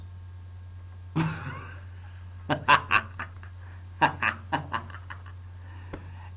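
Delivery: long breathy laugh at his own joke; airy laughter. Real, not acted. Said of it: "Yes, yes".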